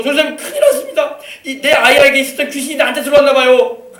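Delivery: loud, high-pitched panicked report; acted alarm. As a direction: act out a mother rushing in frightened for help. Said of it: "막 놀래가지고 이랬다는 거예요". (깜짝 놀라 다급하게 외치는 흉내)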